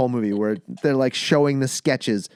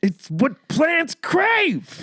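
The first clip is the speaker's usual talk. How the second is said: high, slow dopey voice; dumb guy voice; 4 dB louder, much slower than usual. Retold or imitated. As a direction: drawl it like a dim-witted guy. Idiocracy quote, put-on voice.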